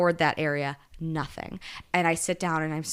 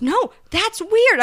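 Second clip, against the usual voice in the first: much higher, louder, slow drawn-out reassurance; 13 semitones higher, 7 dB louder, much slower than usual. Retold or imitated. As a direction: stretch it out high and kind. Manager reassures her.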